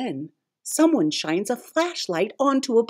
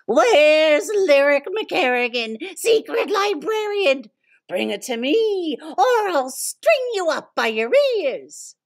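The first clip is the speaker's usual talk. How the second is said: high, menacing villain voice; villain demand; 8 semitones higher than usual. Acted. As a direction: threaten like a cartoon villain.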